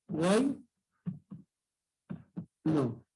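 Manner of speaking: Guay rising, pause, then low No; two syllables with a long gap between; split into syllables. Shown as a demonstration. Teacher divides the word for class, Guay and No separately. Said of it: "Tres vocales en una misma sílaba".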